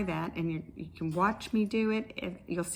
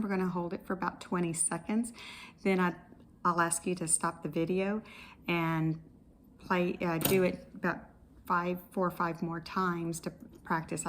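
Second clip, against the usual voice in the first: ordinary talk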